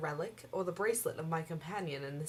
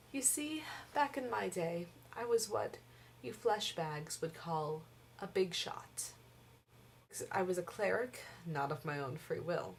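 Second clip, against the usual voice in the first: ordinary talk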